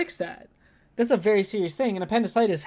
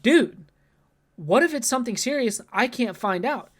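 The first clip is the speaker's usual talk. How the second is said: loud, higher pleading protest; exasperated outburst; 5 semitones higher, 4 dB louder than usual. Retold or imitated. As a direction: push back hard at the nurse. Arguing with the nurse.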